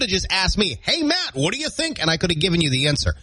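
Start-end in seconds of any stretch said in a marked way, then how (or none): 0.8-2.0 high, light voice asking a question; put-on high-pitched inquirer; imagined questioner. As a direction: pitch it up as someone casually asking for an opinion. Voices an imagined person asking him.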